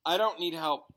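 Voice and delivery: usual speaking voice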